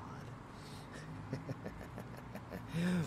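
soft, long staccato chuckle; teasing chuckle. Acted. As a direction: chuckle quietly at the lover. Teasing the lover.

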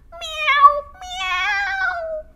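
a desperate cat meowing. A narrator's reading. Hetty stuck and meowing for help. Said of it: "she meowed".

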